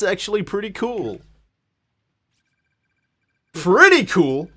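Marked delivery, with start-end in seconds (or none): none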